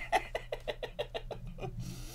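long, soft, wheezing laugh; real helpless giggle fit. Real, not acted. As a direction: dissolve into quiet laughter. Cracking up at retold shut up.

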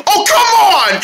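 high, loud-edged whine of exasperation; exasperated protest. Acted. Friend pelted by a thrown object, high exasperated cry. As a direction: protest at an unfair prank.

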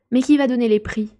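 loud, eager crowd of animals; all asking at once; loud chorus question. A narrator's reading. Animals ask together who gives prizes, loud. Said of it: "demandèrent les autres en chœur".

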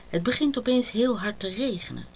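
ordinary delivery, nothing marked

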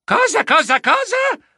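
panicked disbelief, rising; shocked exclamation. Acted. (esclamazione incredula)

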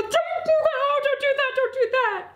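high, frantic crying plea; hysterical. Acted. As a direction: beg in a high, hysterical wail. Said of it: "And then she was hysterical".

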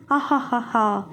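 low, hammed-up villain cackle; villainous laugh. Playing lady don, she lets out a low villain cackle. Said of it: "వికటహాసం చేసి".

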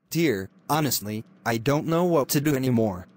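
ordinary talk

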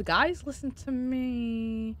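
slow, soft, confiding; hushed urging. Pretend. Streamer confiding in viewers, slow and hushed. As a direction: draw them in with a slow hush.

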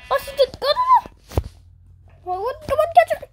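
squealing high no's; frantic high squeal; panicking gamer. Real, not acted. Opponent gets hit, squealing.

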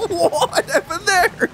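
high, slow words through laughter; real laugh breaking into a high-pitched question; laughing in disbelief. Real, not acted. Player cracking up at what happened, laughing in disbelief.

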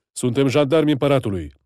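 loud commanding announcement; gendarme shouting. Acted. Gendarme at the gate announcing himself, loud commanding shout. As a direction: call it out through the gate with authority.

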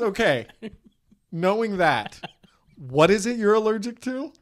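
hammed-up, emphatic questioning; mock exasperated doctor. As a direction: press the question loudly, imitating a doctor losing patience. Retold doctor pressing past the jokes.

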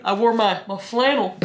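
ordinary delivery

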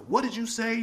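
high, challenging question; delivering a film line. Retold or imitated. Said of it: "where I said".

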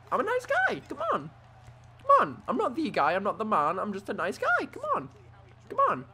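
high whiny begging voice; mock whiny pleading. Pleading with the opponent not to hurt him, mock whiny. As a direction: beg like a scared kid, playfully.

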